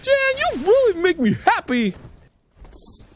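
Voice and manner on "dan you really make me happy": high squeaky mock delight; a high cartoon voice; hammy happy character. Voicing game character.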